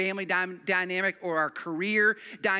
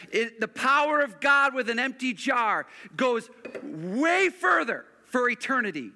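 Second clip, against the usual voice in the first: revival-style pulpit climax; full-force preaching; 4 dB louder than usual. Acted. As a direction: proclaim it to the back row. Pastor preaches the power of God.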